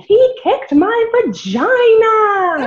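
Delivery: high, slow, laughing mock scream of a wronged kid; loud childish scream. Girl retelling how she yelled after her brother's kick, mock scream. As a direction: scream it like a seven-year-old tattling, laughing through it. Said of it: "I just loudly screamed".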